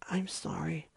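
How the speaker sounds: soft unvoiced whisper; shy pony whispering sorry; timid whisper. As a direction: barely breathe the apology. Timid Fluttershy apology.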